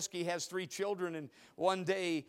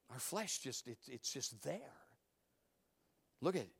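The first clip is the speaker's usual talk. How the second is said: ordinary talk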